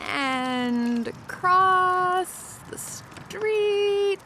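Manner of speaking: very slow, stretched words with effort; straining to make it. Acted. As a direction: draw each word out as you push across. Effortfully steering to beat the light.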